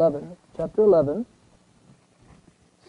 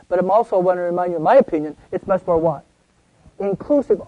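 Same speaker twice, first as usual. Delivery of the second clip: ordinary talk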